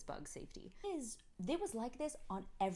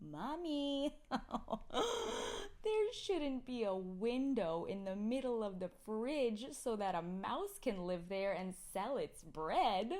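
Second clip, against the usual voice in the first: put-on higher, slow, earnest little kid voice; imitating a small child; 3 semitones higher, 4 dB louder, slower than usual. Mother telling the crowd, as her little kid. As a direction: play the toddler explaining seriously.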